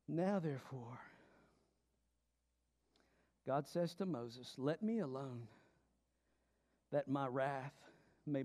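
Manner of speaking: low and soft, letting wrath simmer; hushed solemn reading. Acted. God telling Moses to leave him alone, hushed. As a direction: read it quietly, heavy and grave. Preacher reads God's wrath.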